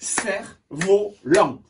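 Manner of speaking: slow "Cerf-volant" in three spaced beats; syllable by syllable. Shown as a demonstration. Teacher counting syllables for pupils, syllable by syllable. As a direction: break "Cerf-volant" into Cerf, vo, lant. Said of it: "Un, deux".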